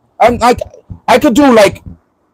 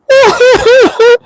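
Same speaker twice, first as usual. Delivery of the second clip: much higher, slow, hammed-up sob-talk of regret; mock crying; 9 semitones higher, slower than usual. Man joking he'd cry in the shower after choking, hammed-up wail. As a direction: wail it like a guilty crybaby in the shower. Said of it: "in the shower crying".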